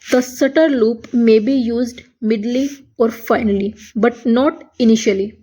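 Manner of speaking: very slow rule read word by word; slow dictation. Shown as a demonstration. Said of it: "reading rule aloud". (धीमे स्वर में नियम पढ़ना)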